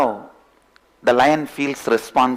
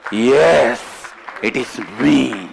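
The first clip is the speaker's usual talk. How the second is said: triumphant roar; much slower than usual. Acted. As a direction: roar it out as self-recognition. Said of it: "The fellow roars".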